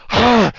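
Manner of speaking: loud, high yelp; pained cry. Acted. GM voicing Ara crying out toward the bear with a loud, high yelp.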